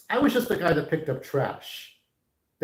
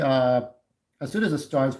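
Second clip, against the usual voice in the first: ordinary talk, nothing marked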